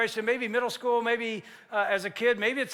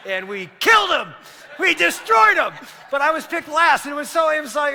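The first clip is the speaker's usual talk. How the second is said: louder, higher gloating shout; triumphant shout; 6 semitones higher, 9 dB louder than usual. Real, not acted. Preacher gloating about beating the students. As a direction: crow about the win. Triumph over cocky kid.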